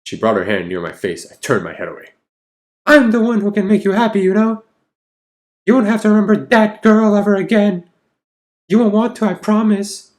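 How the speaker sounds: high, sugary consoling woman's voice; sweet clingy girlfriend. Acted. Anna soothing hurt Jack, high syrupy coo. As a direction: coo at him like she owns him.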